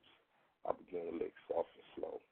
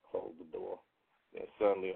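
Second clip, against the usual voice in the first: ordinary delivery, nothing marked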